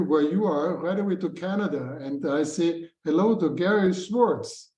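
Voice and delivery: ordinary delivery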